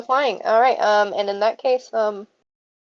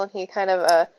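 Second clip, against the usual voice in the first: normal talking voice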